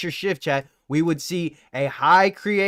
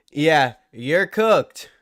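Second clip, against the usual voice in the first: slow taunting drawl; much slower than usual. Shown as a demonstration. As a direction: drag out you're cooked very slowly. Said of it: "repeat slowed".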